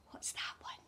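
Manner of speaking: soft unvoiced whisper. Teacher whispers the card's name to children. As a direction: let the reveal stay secret. Hushed reveal of card.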